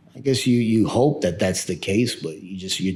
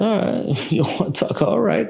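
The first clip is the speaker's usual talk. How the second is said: mock shrug; 12 semitones higher than usual. Dad mocks son's refusal.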